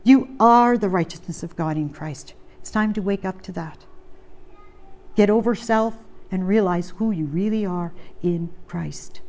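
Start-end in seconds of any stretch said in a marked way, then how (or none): 5.2-9.2 hushed, breathy whisper to the flock. Real, not acted. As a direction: lean in and whisper it. Preacher drops to a whisper.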